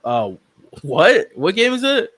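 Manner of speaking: ordinary talk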